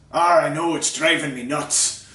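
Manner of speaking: loud, slow growly pirate; pirate groaning about his pants, hammed up. Pirate answers the bartender, a slow loud pirate voice.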